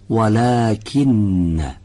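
very slow, drawn-out recitation; Quran recitation. Shown as a demonstration. Teacher reciting line four for students at home. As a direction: stretch each word as in tajwid. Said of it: "خط چهارم رو من میخونم".

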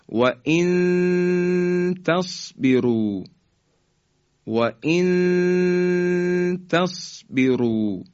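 "و ان تصبروا" with a long high hum on the noon; ikhfa. Shown as a demonstration. Teacher recites example for students to repeat, slow ikhfa. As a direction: draw out the hidden noon in "و ان تصبروا".

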